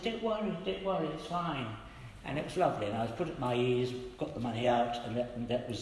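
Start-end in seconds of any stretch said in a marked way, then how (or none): 0.0-1.7 higher, reassuring woman's voice; kind stranger reassuring. Retold or imitated. Woman in the queue reassuring him, higher and kind. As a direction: brush it off kindly, up high. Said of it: "she said".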